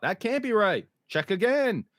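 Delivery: mock freak-out. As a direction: act out Fatima's alarm lightly. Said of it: "Fatima freaks out".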